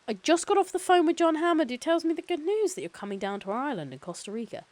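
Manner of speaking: high, loud phone-call pleasantries; chipper company lawyer. Acted. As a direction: play an eager corporate counsel introducing himself. Voices Gennaro on the phone.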